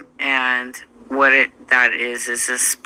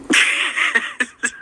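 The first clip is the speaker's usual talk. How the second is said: breathy laugh; 10 semitones higher than usual. Real, not acted. Laugh after quote.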